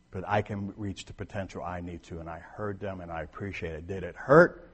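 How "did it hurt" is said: normal talking voice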